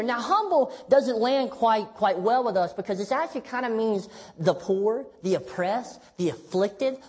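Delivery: ordinary delivery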